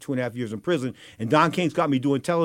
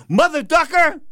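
higher, louder mock cussing; mock curse; 12 semitones higher, 7 dB louder, slower than usual. Don King jabs him with a mock curse, louder and higher. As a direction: bark the censored curse. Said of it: "He goes".

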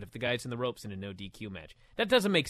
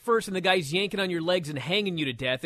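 ordinary talk

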